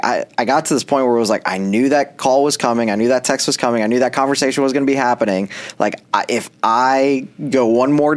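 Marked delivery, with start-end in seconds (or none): none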